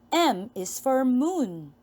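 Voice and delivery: normal talking voice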